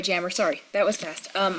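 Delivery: usual speaking voice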